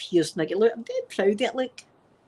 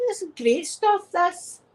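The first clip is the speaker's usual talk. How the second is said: giddy delight; 9 semitones higher, slower than usual. Retold or imitated. Raving about gas.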